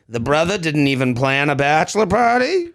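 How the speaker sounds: low, judgmental gossip voice; lightly put-on disapproving old woman.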